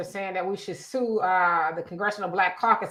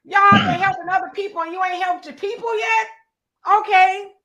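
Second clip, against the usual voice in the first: incredulous outburst; 8 semitones higher, 5 dB louder than usual. Real, not acted. Host indignant at caucus.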